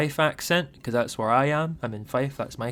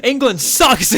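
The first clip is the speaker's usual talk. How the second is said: loud, laughing, higher jab at England; laughing mock insult; 11 semitones higher, 10 dB louder, slower than usual. Laughing at the friend who just came back. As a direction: tease the friend with a laughing shout.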